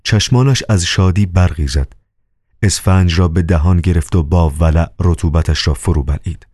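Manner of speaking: usual speaking voice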